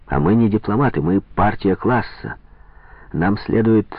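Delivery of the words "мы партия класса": plain reading voice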